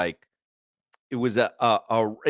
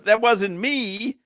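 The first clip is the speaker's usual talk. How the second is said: slow, higher, drawn-out denial; mock Larry denial; 9 semitones higher, much slower than usual. Host voicing Larry denying it was him, slow and high. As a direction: stretch it out like a sheepish athlete. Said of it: "Larry goes".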